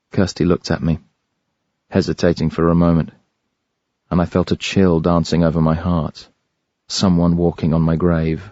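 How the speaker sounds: plain reading voice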